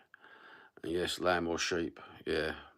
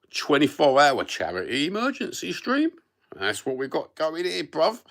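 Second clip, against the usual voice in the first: excited; 9 semitones higher, 6 dB louder than usual. Real, not acted. Hyped announcement of stream.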